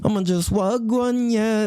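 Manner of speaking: put-on slow, high, laid-back island boy drawl; Florida island boy. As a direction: play the chill Florida island dude, stretched out. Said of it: "Or if you're from Florida".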